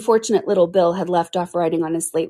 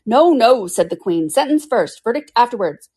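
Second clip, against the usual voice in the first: imperious queen; 5 semitones higher, 5 dB louder than usual. Acted. Queen overrules the king.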